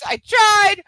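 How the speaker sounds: shouted high and loud in exasperation; exasperated shout. Real, not acted. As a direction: yell it, giving up. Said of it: "I can't".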